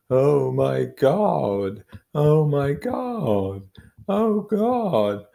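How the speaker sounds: very slow, high, loud wailing cries; a grieving husband wailing beside his wife's body; high horrible call. Acted. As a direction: wail it out, rising into anguish. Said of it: "his high, horrible call".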